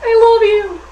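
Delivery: high, loud squeal of love; excited gush. Real, not acted. Fan gushing at the Mandalorian, high excited squeal. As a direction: squeal it at the screen.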